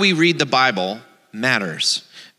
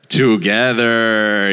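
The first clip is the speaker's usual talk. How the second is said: "together" stretched long and lower; drawn-out emphasis; 4 semitones lower, 3 dB louder, much slower than usual. A narrator's reading. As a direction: hold "together" out slowly for the listeners. Draws out the story's key word.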